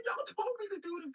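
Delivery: high, whiny mock crying; mock tearful. Caesar blaming his advisor, mock tearful. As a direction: wail the blame in an exaggerated tearful squeal. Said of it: "he breaks down into tears".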